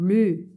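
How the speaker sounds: L first, blended into U as "lu"; syllable "lu". Shown as a demonstration. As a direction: sound out L-U as one syllable, "lu". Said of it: "L U". (syllabe « lu », le L avant le U)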